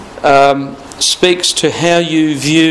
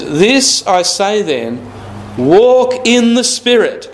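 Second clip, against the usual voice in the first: scripture reading; 6 semitones higher, slower than usual. Acted. Preacher reading scripture aloud.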